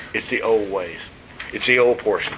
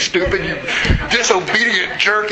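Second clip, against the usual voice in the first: laughing mock insult; 5 semitones higher than usual. Imagined insulting GPS.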